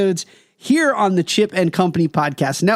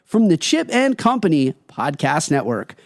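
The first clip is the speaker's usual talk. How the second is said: podcast host intro; 4 semitones higher than usual. Acted. Podcast intro read over music.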